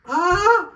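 sudden high cry, half laugh; pained laughing cry. Real, not acted. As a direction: yelp with a laugh. Simulator turned to five.

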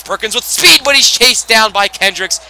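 high and loud, slowing as the chase ends; excited call. Real, not acted. Commentator calling a breakaway run for viewers, high excited call.

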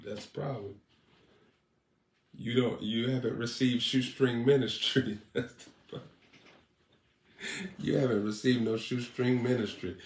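ordinary talk

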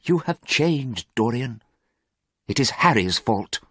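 understated hurt; sad. A narrator's reading. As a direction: reproach him sadly. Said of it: "said Basil sadly".

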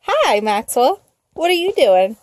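ordinary delivery

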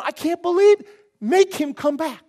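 loud, high exasperated outburst; hammed-up, shouted disbelief; Peter. Disciple urges Jesus to act, loud exasperated protest. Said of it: "He's kinda going".

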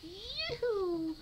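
squeaky high kitten call; kitten yoo-hoo. Retold or imitated. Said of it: "He's like".

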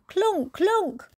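high, odd "clunk, clunk"; piano clunks. Retold or imitated. Sound effect of the piano at night, high and odd. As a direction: clunk it like heavy keys.

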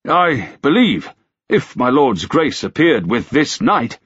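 hesitant, drawn-out offer; tentative. A narrator's reading. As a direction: feel your way gingerly into the proposal. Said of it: "the constable said tentatively".

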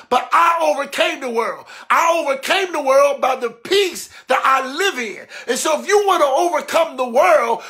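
driving, insistent sermon cadence; full-force preaching. Real, not acted. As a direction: hammer the message home in rhythmic repeats.

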